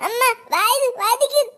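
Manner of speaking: high, tearful call for mother; crying in pain. Acted. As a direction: cry out high to mum, hurting. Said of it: "பிள்ளை அழுகுறியா". (வலியில் அம்மாவை அழைத்து அழுதல்)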